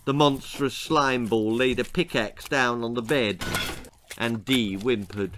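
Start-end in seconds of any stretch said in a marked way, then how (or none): none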